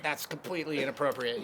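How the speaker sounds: lightly rasped bluesman impression; raspy blues voice. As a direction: rasp it like a gruff blues singer. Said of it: "He had the real raspy blues voice".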